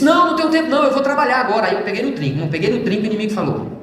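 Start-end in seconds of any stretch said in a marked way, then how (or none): 0.0-1.7 high, loud brusque refusal; an imitated curt brush-off; retold angry refusal. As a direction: push the visitors off sharply. Uncle refuses, standing up.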